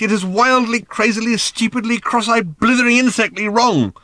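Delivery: high, loud exasperated tirade; ranting. A narrator's reading. Arthur insisting to Ford there's no point, ranting. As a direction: hammer the argument home, overwrought.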